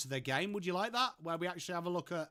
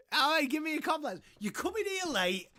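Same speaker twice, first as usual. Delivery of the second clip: higher, mocking sing-song read of the chat; high teasing mimicry of a viewer; mock whine; 10 semitones higher than usual. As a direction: parrot the message back in a teasing voice. Mocking a chat message back.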